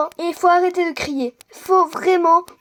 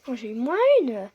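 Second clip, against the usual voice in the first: normal talking voice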